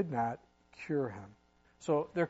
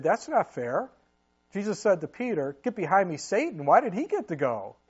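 put-on higher, louder childish complaint; mock jealous whine; 3 semitones higher, 8 dB louder than usual. Disciples voiced jealous.

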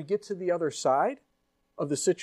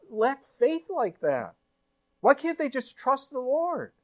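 judgmental disbelief; 7 semitones higher than usual. Acted. Voicing judgmental reader.

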